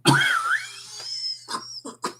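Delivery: harsh rough coughing; coughing fit. Real, not acted. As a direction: cough hard, throat irritated. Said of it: "sorry, this weather change is killing me".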